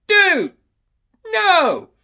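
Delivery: high, loud pleading yell; retold screaming. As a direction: scream it in desperation at the dog. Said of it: "I'm screaming".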